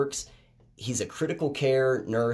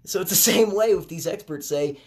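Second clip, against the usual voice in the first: usual speaking voice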